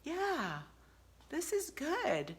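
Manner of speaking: soft, high, slow and understated; mock placid contentment; gently drawn-out, uncomplicated approval; imitating his simple meaning. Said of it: "all he meant was".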